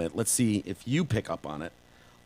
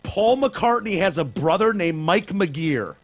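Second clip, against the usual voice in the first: higher, louder, slow disbelief; gobsmacked; 7 semitones higher, 4 dB louder, slower than usual. Real, not acted. Repeating the news about [name]'s brother in gobsmacked disbelief. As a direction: spell out each word, stunned. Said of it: "I'm gobsmacked by that".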